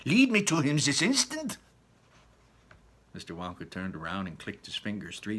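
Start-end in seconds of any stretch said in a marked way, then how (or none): 0.0-1.6 snapping. Acted. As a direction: snap at him. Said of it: "snapped Missus Gloop".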